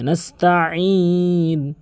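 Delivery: "নাস্তাইন" slow, throaty, with long ee; correct throaty ayn. Shown as a demonstration. Teacher saying do it this way, slow throaty recitation. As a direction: draw out "নাস্তাইন" from mid-throat. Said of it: "এভাবে".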